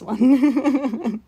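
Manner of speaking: slow, giggling through the words; laughing. Real, not acted. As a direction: laugh while picking it. Laughing while choosing a drawing.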